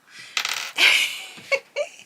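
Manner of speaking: high, breathless giggle at the stuck lid; high laugh. Real, not acted. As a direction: burst into a squeaky, airy laugh. Laughs after failing with the lid.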